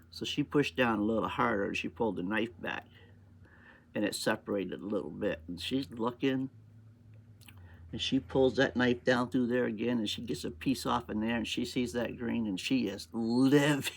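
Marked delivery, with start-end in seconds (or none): none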